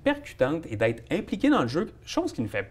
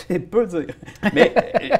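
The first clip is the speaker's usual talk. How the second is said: laughing; 5 semitones higher, 4 dB louder, slower than usual. Real, not acted. Laughs at teammate's joke.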